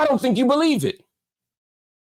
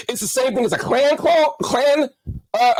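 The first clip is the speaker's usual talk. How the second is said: normal talking voice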